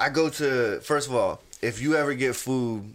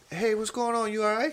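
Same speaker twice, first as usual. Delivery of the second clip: higher-pitched, lightly voiced female manager; imitated manager's greeting; 8 semitones higher, 3 dB quieter than usual. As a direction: check on a customer in a high voice. Voicing manager greeting a customer.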